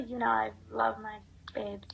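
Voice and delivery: whispered aside to herself. Real, not acted. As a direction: breathe it out under your breath.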